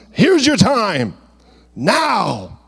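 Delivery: lightly voiced God urging him on; God's call. Pastor voicing God to Jesus, slow and lifted.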